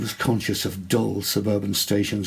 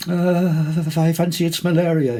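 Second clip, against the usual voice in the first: nervous malaria excuse; 8 semitones higher, 6 dB louder than usual. A narrator's reading. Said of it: "his teeth chattering slightly".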